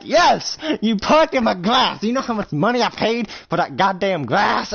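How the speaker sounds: loud, high-pitched rant; irate outburst. Acted. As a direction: yell it in outraged disbelief. Acted quarrel over parked-on lawn.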